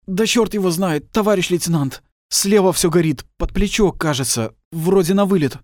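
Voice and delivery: high, loud reply through pain; strained answer. Acted. Wounded soldier answering the lieutenant loudly. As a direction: shout back, hurt but brave.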